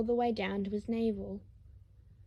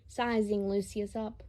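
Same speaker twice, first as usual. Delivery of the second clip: ordinary delivery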